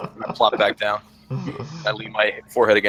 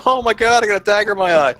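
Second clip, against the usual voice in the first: high, loud mock wail; play-acted freak-out over an injury; mock panic; 11 semitones higher, 6 dB louder than usual. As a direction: cry out in hammed-up alarm. Mimes hypothetical dagger accident panic.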